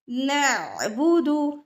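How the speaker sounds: नअबुदु slow with a held throat stop; slow example. Shown as a demonstration. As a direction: clip the saakin ain. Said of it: "जज़म वाली आइन की मिसालें". (नअबुदु धीरे, आइन रोक कर)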